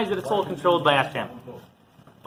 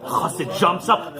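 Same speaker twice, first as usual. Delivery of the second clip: usual speaking voice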